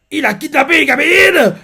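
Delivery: high, loud accusing shout; shocked outcry. Retold or imitated. Three friends accusing Lance together, high loud outcry. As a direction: yell it in horror. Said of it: "Il dit ça en simultané".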